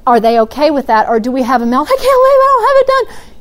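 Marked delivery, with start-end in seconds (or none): 1.9-3.3 high, put-on voice of an upset kid; whiny child. Parent voicing a child about to have a meltdown, high and whiny. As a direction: whine it like a child mid-meltdown.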